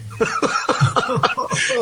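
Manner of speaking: high unvoiced chuckle; airy breathless laugh. Real, not acted. Recalling the Sandberg game to Bobby, airy laugh. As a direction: let a breathy laugh slip out.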